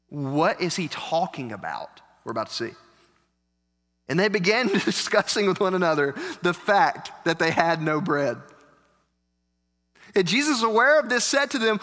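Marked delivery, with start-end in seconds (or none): none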